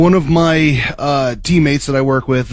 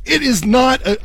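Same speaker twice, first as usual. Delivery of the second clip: pitched up high in mock protest; retold disbelief; 10 semitones higher than usual. Retelling arguing with Minnesota coworker, high mock protest. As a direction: protest like it can't be true. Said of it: "I was beside myself shocked".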